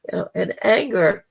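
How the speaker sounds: ordinary talk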